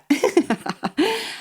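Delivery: coughing laugh, like a smoker's; wheezy laugh. Real, not acted. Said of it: "like a smoker's cough".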